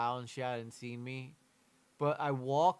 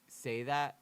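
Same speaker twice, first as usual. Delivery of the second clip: usual speaking voice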